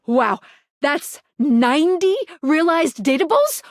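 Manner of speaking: normal talking voice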